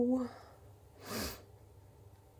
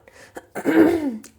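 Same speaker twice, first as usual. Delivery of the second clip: loud rough throat clearing; dry cough; 6 semitones higher, 12 dB louder than usual. Real, not acted. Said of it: "because I can't speak".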